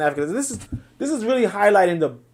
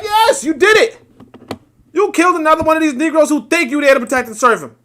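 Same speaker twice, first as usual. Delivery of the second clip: much higher and louder, gloating cheer; mock cheering; 7 semitones higher, 7 dB louder than usual. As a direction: cheer the police on like a gleeful bigot. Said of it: "They're like".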